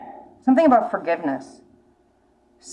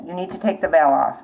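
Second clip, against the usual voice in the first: ordinary delivery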